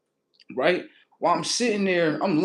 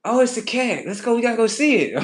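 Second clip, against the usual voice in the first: higher, eager retold reaction; excited exclamation; 6 semitones higher, 3 dB louder, faster than usual. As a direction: act out spotting something exciting. Excited on spotting the cat.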